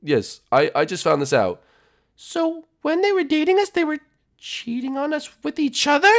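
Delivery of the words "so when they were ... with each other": high falsetto, halting; stunned princess voice. Acted. Princess learning she was cheated on, high and stunned. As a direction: piece it together in a high voice.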